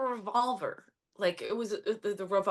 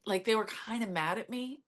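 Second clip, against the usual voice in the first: usual speaking voice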